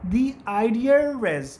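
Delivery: "the idea is" with an r sound slipped between idea and is, said slowly; linking r demo. Shown as a demonstration. Teacher showing students the linking r, drawn out slowly. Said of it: "आप वहाँ पे आर sound add कर देंगे".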